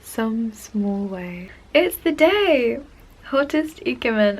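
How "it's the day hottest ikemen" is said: excited. Real, not acted. Fan thrilled to announce her idol's birthday, excited high call. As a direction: announce the big day with glee.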